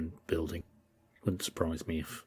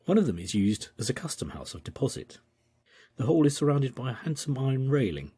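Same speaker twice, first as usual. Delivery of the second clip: higher, louder formal reading voice; journal reading; 5 semitones higher, 5 dB louder than usual. Acted. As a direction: read the old journal aloud with ceremony. Reads journal passage aloud.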